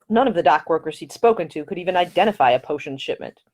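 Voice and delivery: ordinary delivery, nothing marked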